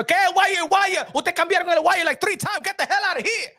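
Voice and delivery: high, hammed-up rant in Spanglish; mock angry yelling. Streamer retelling kicking out the technician, mock angry yelling. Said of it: "Le dije así".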